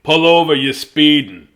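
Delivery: loud, stern shout to pull over; police officer yelling. Acted. Police officer to speeding Loretta, loud stern shout. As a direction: bark it like a cop at a speeding kid.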